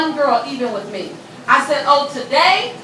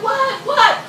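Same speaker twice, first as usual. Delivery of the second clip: confused; 9 semitones higher than usual. Retold or imitated. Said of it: "I'm like".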